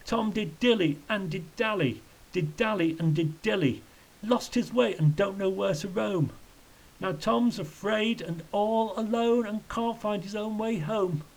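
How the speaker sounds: high, mournful singsong; acted misery in rhyming verse; wretched lament. A narrator's reading. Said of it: "shaking his head wretchedly".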